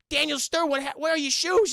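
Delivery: loud, high mock bewilderment; exasperated yelling. One burglar to his shoeless partner, exasperated yelling. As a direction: holler it at a hopeless partner. Acting Joe Pesci's exasperated question.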